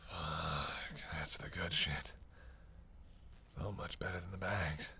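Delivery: low, soft, slow relish; savoring murmur. Acted. Vampire tasting fresh blood, low savoring murmur. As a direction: sink into the pleasure, voice dropped.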